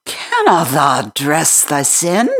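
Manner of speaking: slow, amazed broad Yorkshire. Acted. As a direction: drop into thick Yorkshire dialect. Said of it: "She spoke in a broad Yorkshire in her amazement".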